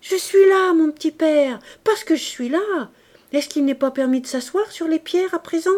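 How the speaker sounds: put-on high, lively girl's voice, full of cheek; playful, teasing daughter. A narrator's reading. Daughter throwing her arms round father's neck, playful. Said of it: "Éponine se mit à rire et lui sauta au cou".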